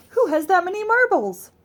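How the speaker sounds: high, loud bafflement; puzzled wonder. A narrator's reading. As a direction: ask in amazement. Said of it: "said Amelia Bedelia".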